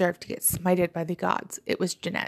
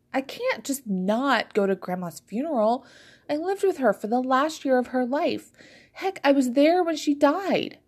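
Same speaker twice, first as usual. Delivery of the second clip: pleading protest; 6 semitones higher than usual. A narrator's reading. Sister protests to Fizzy.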